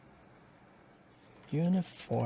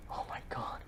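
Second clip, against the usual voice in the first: horrified whisper; 13 dB quieter than usual. Acted. Collapsed, seeing the fire.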